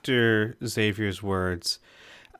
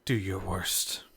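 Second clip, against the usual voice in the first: quiet, low puppet mutter; a lightly voiced sock puppet; goofy muffled sock puppet; 4 semitones lower, 9 dB quieter than usual. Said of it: "Puppet voice".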